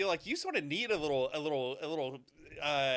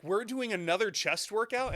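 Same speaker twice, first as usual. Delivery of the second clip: higher-pitched mock griping question; complaining; 5 semitones higher than usual. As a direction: imitate a gym buddy's complaint. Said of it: "he just complained the entire time".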